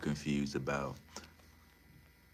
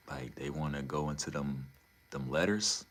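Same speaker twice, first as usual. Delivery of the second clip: normal talking voice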